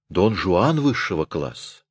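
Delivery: ordinary delivery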